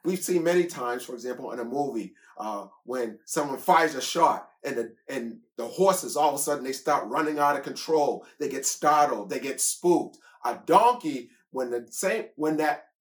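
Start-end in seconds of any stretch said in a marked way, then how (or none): none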